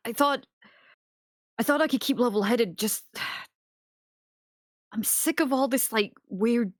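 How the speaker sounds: ordinary talk, nothing marked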